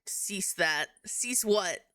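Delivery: ordinary talk, nothing marked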